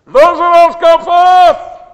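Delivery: huge, high full-force shout; megaphone-loud voice. Acted. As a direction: bellow it to wake the dead. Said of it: "He used a loud voice".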